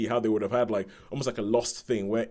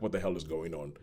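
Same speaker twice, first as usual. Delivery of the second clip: low, quiet aside of confusion; mock bafflement; 6 semitones lower, 8 dB quieter than usual. Baffled viewer reacting to a film's ending, mock bafflement in an undertone. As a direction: drop it low and soft, as if baffled.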